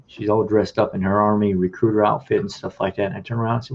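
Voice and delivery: usual speaking voice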